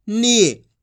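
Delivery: "नी" pulled long with force; long drawn-out ee. Shown as a demonstration. Teacher demonstrating the stretched reading, "नी" firm and long. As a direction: lean on "नी" and stretch the ee. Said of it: "खींच के पढ़ेंगे अपन इसको".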